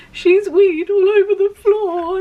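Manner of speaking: high, loud, drawn-out mock sobbing; hammed-up crying, every word stretched; tearful wail. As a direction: wail it through exaggerated tears. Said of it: "I just burst into tears".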